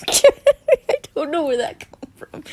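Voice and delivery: loud, slow words through laughter; laughing. Real, not acted. Reader surprised by own outburst, laughing. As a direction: crack up while talking.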